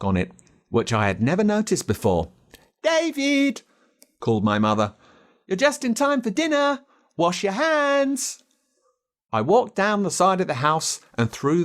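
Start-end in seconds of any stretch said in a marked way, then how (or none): 2.8-3.6 mother calling. A narrator's reading. 5.5-8.4 mother's voice. A narrator's reading. Mother calling David in to dinner, high-voiced.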